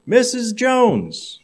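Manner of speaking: drawn-out high call of her name. Acted. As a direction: stretch her name out loud and high, summoning her. Boss calls his secretary on phone.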